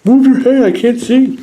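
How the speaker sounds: high, loud mock whine at the toy; hammed-up high-pitched protest; mock complaining troll. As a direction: play the blinded troll whining. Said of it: "He's like".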